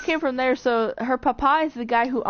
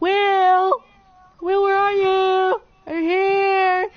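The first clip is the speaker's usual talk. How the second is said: high, loud, drawn-out search call; acted calling out; 8 semitones higher, 6 dB louder, slower than usual. Mike searching the woods at night for Will, calling out. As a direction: call slowly into the distance for a lost friend.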